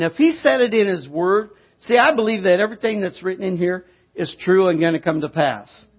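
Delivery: normal talking voice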